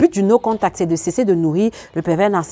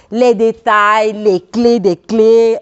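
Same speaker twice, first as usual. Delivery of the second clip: very slow, louder, stretched syllables; drawn-out teasing; 5 dB louder, much slower than usual. Real, not acted. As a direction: dangle each word slowly and loudly. Teasing paid content. (très lent, syllabes étirées, taquin)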